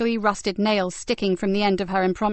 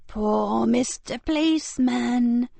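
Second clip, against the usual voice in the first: sneering, drawn-out mock sympathy; slow mocking pity; 3 semitones higher, slower than usual. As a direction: savor the sarcasm slowly. Frankie mocking the fallen officer.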